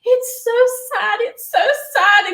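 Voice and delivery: high, slow mock wail; tearful. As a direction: imitate yourself bursting into tears, high and drawn out. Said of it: "start burst into tears".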